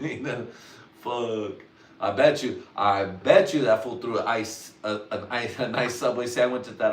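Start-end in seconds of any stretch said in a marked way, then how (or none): none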